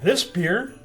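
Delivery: usual speaking voice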